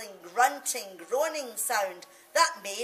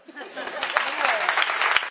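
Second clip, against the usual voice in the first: laughing; 3 semitones higher, 6 dB louder than usual. Real, not acted. Audience-like laugh after poem.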